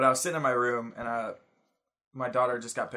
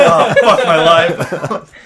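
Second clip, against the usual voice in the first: loud, slow, laughing despair; laughing groan; 6 semitones higher, 17 dB louder, much slower than usual. Real, not acted. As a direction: drag it out through laughter. Laughing at his own mistake.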